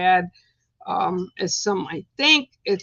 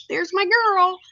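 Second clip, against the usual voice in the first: pet talk; 10 semitones higher, 4 dB louder than usual. Real, not acted. Greeting her bird Pip.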